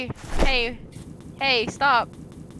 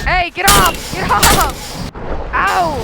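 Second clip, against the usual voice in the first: raised, higher-pitched protest; yelling off attacker; 5 semitones higher, 4 dB louder than usual. Pretend. Player attacked by the bird, yelling in protest. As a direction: shout the attacker away.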